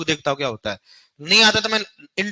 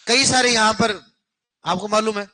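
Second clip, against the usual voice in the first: usual speaking voice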